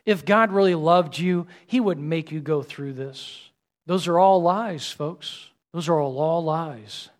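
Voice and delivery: ordinary talk, nothing marked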